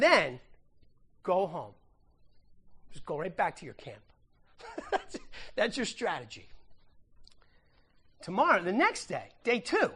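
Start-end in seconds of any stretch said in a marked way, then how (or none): 4.8-6.5 chuckling through the punchline; laughing. Real, not acted. Pastor amused at the battle plan, laughing. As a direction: laugh it out, softer.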